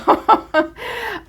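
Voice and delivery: high giggle; high laugh. Real, not acted. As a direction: let out a high, bright laugh. Laugh after the wow.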